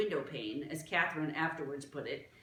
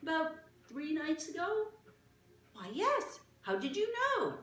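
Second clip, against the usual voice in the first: put-on lightly raised, eager girl's voice; a curious little girl, higher than the narration; young Martha; 12 semitones higher than usual. Martha asks the small gentleman, eager girl voice.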